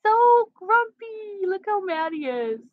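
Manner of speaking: very high, drawn-out cartoon cat; voicing the cat. Owner makes her grumpy cat greet the viewers, squeaky put-on voice. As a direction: speak for the pet in a squeaky, stretched-out voice.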